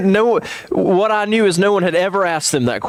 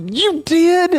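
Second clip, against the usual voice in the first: surprised. Retold or imitated. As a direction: gasp it out in amazement. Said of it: "I was so excited".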